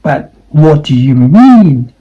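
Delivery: normal talking voice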